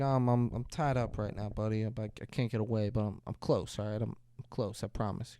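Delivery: soft, low voice of a father; hushed, low fatherly promise; gentle reassurance. Retold or imitated. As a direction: quietly reassure a child, acted out. Retold father reassuring his sick son.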